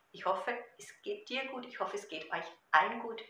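ordinary talk

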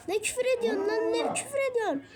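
high, fast scolding teacher; shrieking scold. Acted. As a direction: snap at the student fast and high. (öğrenciyi tiz sesle azarlayan öğretmen)

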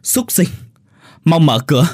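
high, loud furious yell; wife yelling to break in; yelling through the door. A narrator's reading. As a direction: bellow at him through the door. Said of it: "Mụ Hoa gào lên ngoài cửa".

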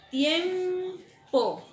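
"tiempo" agudo y fuerte, bien separado; dictado marcado. Shown as a demonstration. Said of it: "tenemos la palabra". ("tiempo" dictado con fuerza)

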